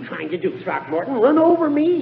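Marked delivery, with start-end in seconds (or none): 1.1-2.0 high, loud indignant protest; pompous outrage, pitched up and louder. Acted. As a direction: bristle at nearly being run down. Judge nearly hit by car.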